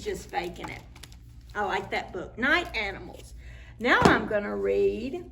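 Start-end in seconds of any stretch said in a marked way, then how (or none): none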